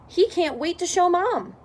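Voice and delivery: ordinary delivery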